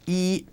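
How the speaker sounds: yi said on a high, level first tone. Shown as a demonstration. Teacher demonstrating to learners, high level yi. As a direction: hold yi high and flat. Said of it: "number one is a first or high level tone".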